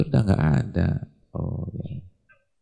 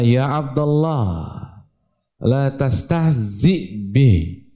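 slow recitation; 4 semitones higher, much slower than usual. Acted. Preacher recites hadith, drawn out.

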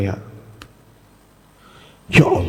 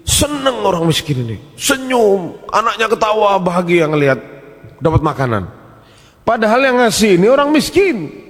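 much higher, swelling preacher voice; moved preaching; 10 semitones higher than usual. Acted. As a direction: let the joy of the story lift you. (khotbah penuh haru dengan nada tinggi)

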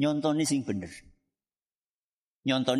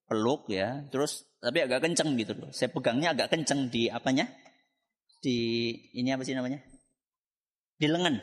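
ordinary delivery

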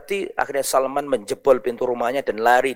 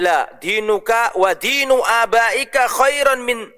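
higher, slow, emphatic Arabic; stern father; 7 semitones higher, slower than usual. Acted. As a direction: lecture the son with weight. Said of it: "Maka tanya". (ayah menasihati dengan tegas dan lambat)

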